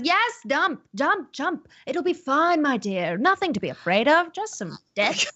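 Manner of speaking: high-pitched, coaxing character voice; Larkin. Acted. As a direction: urge them on in a high, swooping voice. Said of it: "Larkin says".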